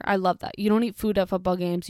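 usual speaking voice

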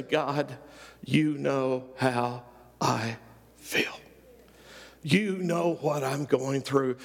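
slow, hushed voice of a grieving father; broken, slow prayer. Retold or imitated. Father who lost his son, speaking slowly and brokenly to God. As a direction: let the words drag out of a broken heart. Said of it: "And I had to say".

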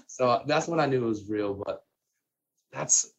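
usual speaking voice

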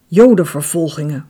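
usual speaking voice

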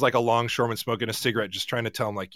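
ordinary talk

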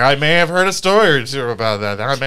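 teasing false modesty; coy understatement. Retold or imitated. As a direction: play it sly, like a secret expert. Coyly hinting at expertise.

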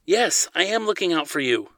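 firm, high-pitched reply; authoritative. A narrator's reading. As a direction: lay down the law. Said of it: "Zadeh replied with authority".

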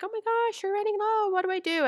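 high, panicky customer squeal; panicked customer. Retold or imitated. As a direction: play the frantic buyer worried stock is gone. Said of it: "I had a customer reach out to me like".

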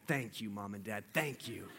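very soft, sly thanks with a stifled laugh; hushed mocking thanks. Under the bed, quietly gloating at the parents who leave. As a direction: gloat under your breath so nobody hears.

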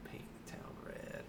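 whispered echo. Real, not acted. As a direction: breathe the words softly.

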